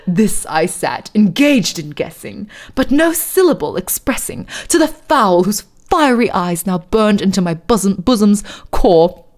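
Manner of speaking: poem recited in put-on British; hammed-up British poetry reading; fake British accent. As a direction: read dramatic verse in a mock British accent. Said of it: "middle of a fake British accent".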